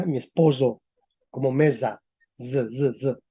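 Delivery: "esposo" and "mesa" as "espozo" and "meza", then buzzed "z, z, z"; medieval voiced s. Shown as a demonstration. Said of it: "como mesa".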